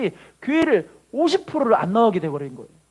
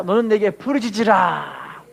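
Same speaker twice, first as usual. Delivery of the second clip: slow, deliberate recitation for the church to repeat; slow call and response; slower than usual. Shown as a demonstration. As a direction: say it slowly so they can follow. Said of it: "따라합시다".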